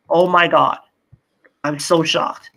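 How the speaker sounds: usual speaking voice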